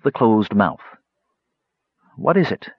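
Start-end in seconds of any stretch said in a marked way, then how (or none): 2.2-2.7 suspicious. A narrator's reading. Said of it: "she said suspiciously".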